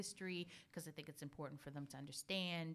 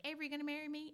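playful teasing; 10 semitones higher, 3 dB louder, faster than usual. Retold or imitated. Mom teasing her son.

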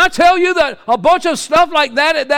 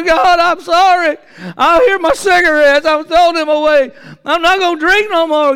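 hammed-up sobbing sinner at the altar, pitch raised; mock tearful penitent; 5 semitones higher, 4 dB louder than usual. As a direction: wail a fake altar confession. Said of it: "Not just cry and say".

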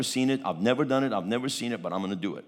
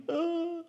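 high, softer wail; high mock sob; imitated crying; 4 dB quieter than usual. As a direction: mimic a girl's crying "Ah". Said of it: "The girl's crying".

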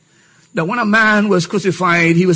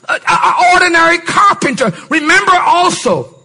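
raised, ringing sermon voice; full-force preaching; 9 semitones higher than usual. Acted. Preacher lifting the hall, high and forceful. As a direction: preach it high and strong to the congregation.

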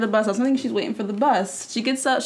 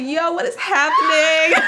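slow, high laughing question; laughing disbelief; 6 semitones higher, 4 dB louder, slower than usual. Retold or imitated. Bystander at the bus stop, laughing in disbelief. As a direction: crack up asking what's happening. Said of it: "I'm like".

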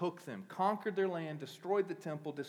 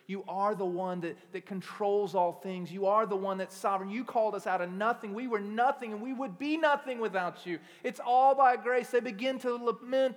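loud, high, impassioned sermon voice; full-force preaching; 7 semitones higher, 10 dB louder than usual. Acted. Pastor rousing the congregation, loud fervent preaching. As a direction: drive it home to the church.

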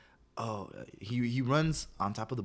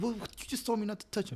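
mock defensive; 14 semitones higher than usual. Speaker answers the teacher.

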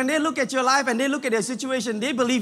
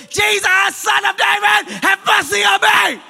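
a blind beggar yelling for help; desperate shout; 10 semitones higher, 10 dB louder, slower than usual. Acted. Blind Bartimaeus shouting to Jesus from the roadside, desperate loud cry. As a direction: cry it out at the top of your lungs, slow and stretched. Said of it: "hears this voice from far away".

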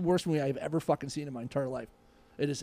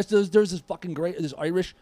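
ordinary delivery

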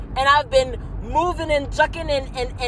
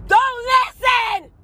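much higher, loud, slow yell; defiant shout; 9 semitones higher, 4 dB louder, much slower than usual. Real, not acted. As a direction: hurl it at the critics.

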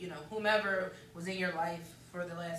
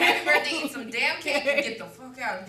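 loud, higher mock send-off; mock rude shout; 4 semitones higher, 11 dB louder than usual. Host to party guests, mock loud send-off. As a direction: yell it like kicking guests out.

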